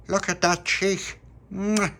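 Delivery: put-on high, slow and loud cooing over the baby; cooing puppy. A narrator's reading. The little puppy coos at baby Lucia, high and loud. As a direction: coo like a fond puppy greeting a baby.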